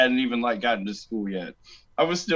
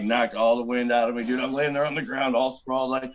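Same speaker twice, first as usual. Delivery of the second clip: ordinary talk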